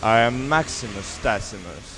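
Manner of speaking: deep hero voice, repeating after him; Gladiator impression. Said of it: "after me".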